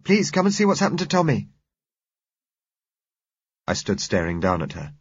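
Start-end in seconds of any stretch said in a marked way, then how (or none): 0.0-1.5 put-on high, loud, pleading child; urgent little girl. A narrator's reading. Girl begs driver to see Tommy, urgent. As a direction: plead urgently in a raised girlish voice. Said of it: "she said urgently".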